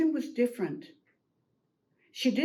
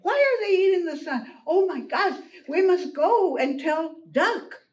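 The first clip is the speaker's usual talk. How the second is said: high, agitated rooster's outburst; all excited; 5 semitones higher, 4 dB louder than usual. Acted. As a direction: fire off questions in a fluster. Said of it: "and all excited".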